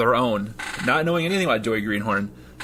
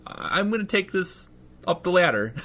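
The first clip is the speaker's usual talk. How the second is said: rookie cop; 8 semitones higher than usual. Acted. GM voicing rookie cop Joey.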